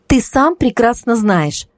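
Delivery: slow, sharp and cutting; sharply. A narrator's reading. Kristina answering her ex, sharp. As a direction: cut him off sharply. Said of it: "ответила Кристина резко".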